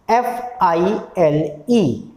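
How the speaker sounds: F, I, L, E spelled slowly letter by letter. Shown as a demonstration. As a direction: say "एफ़ आई एल ई" slowly, one letter at a time. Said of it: "जब आप इसका उच्चारण करते हैं". ("एफ़ आई एल ई" धीरे-धीरे, एक-एक अक्षर)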